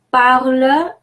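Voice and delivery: Parle as "parl-euh", no S; silent final S. Shown as a demonstration. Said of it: "the final S is silent".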